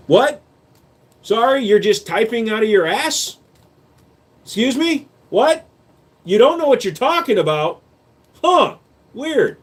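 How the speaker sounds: high-pitched, sarcastic incredulity; mock disbelief. Streamer challenges a viewer's claim about gun law, high mock-baffled questions. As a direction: pitch every question way up, feigning confusion.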